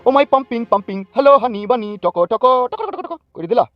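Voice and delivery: high, singsong taunt; mocking sweet talk. Bully mockingly mimics lover's sweet talk.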